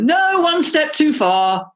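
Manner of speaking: high, slow, firm refusal; defiant refusal. Retold or imitated. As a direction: put your foot down, slow and high. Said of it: "And they went".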